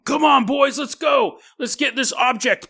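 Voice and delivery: a mock sergeant yelling his men forward; soldier rallying troops. US troops charging the dam.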